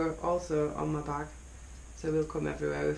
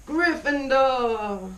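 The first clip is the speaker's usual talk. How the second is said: house cheer; 7 semitones higher, 5 dB louder, much slower than usual. Acted.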